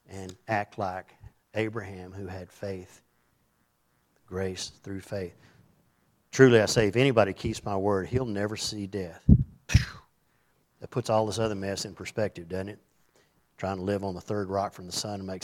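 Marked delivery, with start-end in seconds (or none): none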